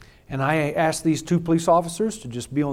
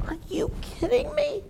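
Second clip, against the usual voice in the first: much higher disbelieving question; incredulous; 4 dB quieter than usual. Real, not acted. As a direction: throw it back in astonishment.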